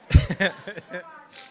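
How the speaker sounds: a bright burst of relieved laughter after nearly crying; laughing at himself after keeping it together. Real, not acted. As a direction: laugh the tension off. Laughs after holding back tears.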